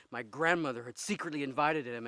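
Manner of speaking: ordinary talk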